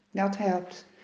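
ordinary delivery, nothing marked

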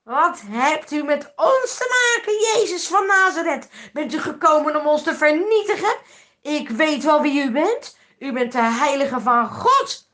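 loud, high, wild shouting at Jesus; possessed man shouting. Acted. Possessed man in the synagogue cries out at Jesus, loud shouting. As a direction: scream it out as a tormented man. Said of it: "Hij roept".

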